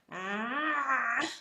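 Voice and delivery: strangled throat ah without the hand; ah squeezed off in the throat; choked ah. Shown as a demonstration. Teacher showing the choke without the hand, strangled ah. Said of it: "choke yourself without your hand".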